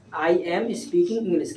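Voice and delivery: slow, even model sentence for the class to repeat. Shown as a demonstration. Teacher slowly models the English sentence for a shy pupil. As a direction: spell it out slowly, word by word. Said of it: "कैसे बोलेंगे?".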